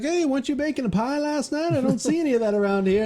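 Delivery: put-on old farmer doctor. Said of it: "he's like, he's like".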